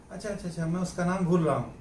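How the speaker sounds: quick, offhand chat delivery; casual conversational aside. Acted. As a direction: run it together as in everyday conversation. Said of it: "बातचीत हो रही है, उसमें आदमी कहता है कि". (आम बातचीत जैसा, जल्दी-जल्दी)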